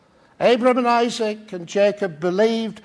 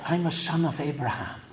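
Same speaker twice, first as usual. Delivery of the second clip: low, soft mock-secret disclosure; conspiratorial whisper; 7 semitones lower, 9 dB quieter than usual. Speaker reveals his claim to the Israeli woman, mock-conspiratorial. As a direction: confide it quietly, well below usual pitch. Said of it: "lowered my voice to a conspiratorial whisper".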